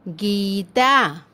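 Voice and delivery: गीता with a long, held गी; slow teaching demo of गीता. Shown as a demonstration. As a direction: stretch the ee in गीता. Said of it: "शब्द का उच्चारण थोड़ा लंबा होता है".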